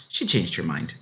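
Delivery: ordinary delivery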